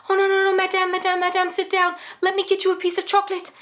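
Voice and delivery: high-pitched flustered gentleman; fussing kind banker. Retold or imitated. Banker fussing over crying customer.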